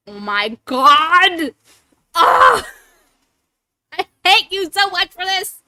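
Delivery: real screams of dismay, very loud and high; a drawn-out shriek of horror, then a yelled complaint; shrieking loud and high, hating every second; screaming. Real, not acted. Screaming after the Valley Girl redeem.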